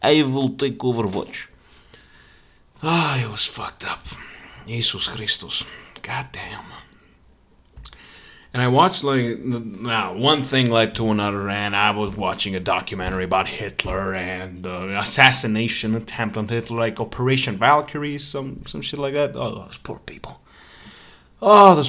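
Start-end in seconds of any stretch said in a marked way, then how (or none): 0.0-1.5 high, loud, slow mock drunk comrade; drunk Soviet soldier. Imitating a drunk soldier taking the gun, mock slow Russian voice. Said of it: "because he'd be fucking drunk". 19.4-20.4 whisper. Pretend. Whispered lament then repeated loud.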